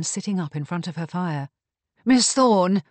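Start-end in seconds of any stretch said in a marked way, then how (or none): 2.0-2.8 loud, raised cry of relief; acted exclamation, higher and louder. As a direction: hail her urgently. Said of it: "Mariana cried".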